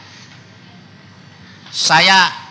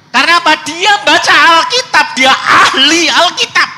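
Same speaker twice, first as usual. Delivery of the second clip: high, loud and slowed, hammering it home; preaching at full force; 13 semitones higher, 6 dB louder than usual. Real, not acted. Preacher rising to climax. (berkhotbah dengan suara tinggi dan keras)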